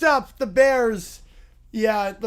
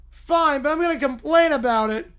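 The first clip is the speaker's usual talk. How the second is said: high, grudging whine; whiny Little Bear; 6 semitones higher, 8 dB louder than usual. Acted. Little bear agreeing to the company, whiny surrender. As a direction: give in while still protesting.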